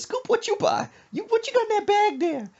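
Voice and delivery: high, loud demanding squawk; nosy mama voice. Retold or imitated. Mother questioning son about his bag, high squawky demand. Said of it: "She says".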